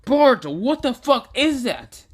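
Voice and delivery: high, loud, slow shocked yell; shocked outburst. Acted. Naruto shocked at what his son did, shouting. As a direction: gape and shout it.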